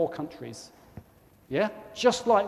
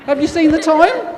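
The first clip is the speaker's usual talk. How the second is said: laughing; 11 semitones higher, 11 dB louder than usual. Real, not acted. Joking about the stuck clock.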